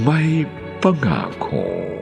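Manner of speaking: ordinary delivery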